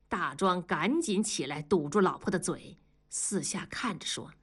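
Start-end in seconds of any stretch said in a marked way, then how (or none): none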